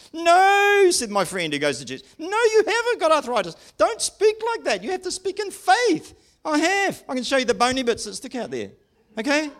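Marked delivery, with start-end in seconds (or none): 0.1-0.9 high, loud, drawn-out mock "No"; scolding objection. Church friend correcting him about arthritis, mock scolding. 2.2-6.1 very high, mock-pious telling-off; scolding church friend. Church friend telling him to speak in faith, mock scolding. 6.4-9.5 high-pitched, insistent comeback; protesting retort. Retold or imitated. Preacher answering the church friend about his arthritis, protesting.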